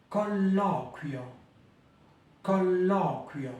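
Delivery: Colloquio slowly, kwjo gliding; careful teacher model; slow Italian colloquio. Shown as a demonstration. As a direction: stretch Colloquio, uio in one syllable. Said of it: "ce mot-là est assez complexe".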